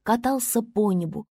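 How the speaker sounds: usual speaking voice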